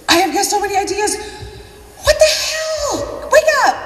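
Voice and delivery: high, hammed-up exasperation building to a shout; frantic nagging. Team member messaging the sleeping seller at night, frantic nagging. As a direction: rant in a high voice, building to a yell.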